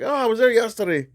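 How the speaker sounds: put-on high, quick Southern kid voice; a hint of Southern twang, cheerful; southern accent. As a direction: lightly mimic the cart kid's Southern accent. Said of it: "He was like".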